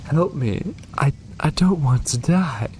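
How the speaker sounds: high, frightened plea for life; pleading victim. Acted. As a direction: beg not to die, voice high. Voicing a dying victim.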